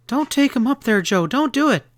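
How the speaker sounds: hysterical plea. A narrator's reading. Kitty begs Joe not to go upstairs, hysterical. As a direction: beg him in a high, panicked cry. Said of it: "Her voice rose hysterically".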